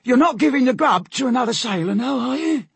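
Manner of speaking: high, loud suspicious sailor; accusing sailor. A narrator's reading. Hungry Bob suspects the boys of sharing grub, accusing high voice. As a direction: challenge them in a high put-on voice.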